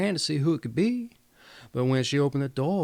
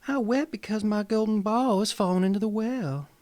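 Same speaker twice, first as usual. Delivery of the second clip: higher princess voice, a touch tearful; lightly voiced princess; 7 semitones higher, 3 dB louder than usual. Princess telling the frog about her ball, higher and lightly voiced. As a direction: lift it into a mild, sorry princess. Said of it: "she said".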